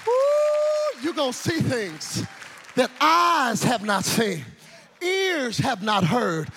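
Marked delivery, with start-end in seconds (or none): 0.0-1.0 high, sustained woo; ringing revival holler; preacher's whoop. Real, not acted. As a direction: let out an exultant high whoop. Preacher whoops at sermon climax.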